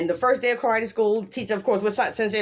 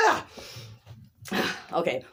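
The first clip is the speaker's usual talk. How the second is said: ordinary delivery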